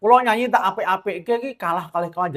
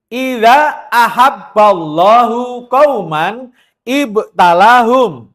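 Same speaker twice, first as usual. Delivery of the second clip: slow, louder chanted Arabic; hadith recitation; 4 semitones higher, 9 dB louder, much slower than usual. Acted. Preacher repeats the hadith to the congregation, slow and drawn out. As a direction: stretch "Idza ahabballahu qauman ibtalahum" slowly, leaning on "Idza".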